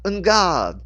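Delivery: loud, high battle cry; shout. A narrator's reading. Genevieve attacks Rachel with a shouted challenge. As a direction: call out the challenge. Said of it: "brought her sword up to attack".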